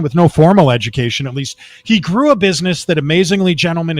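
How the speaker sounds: ordinary delivery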